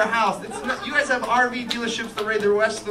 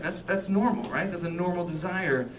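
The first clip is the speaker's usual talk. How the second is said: ordinary talk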